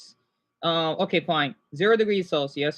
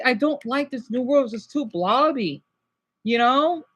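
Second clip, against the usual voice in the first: usual speaking voice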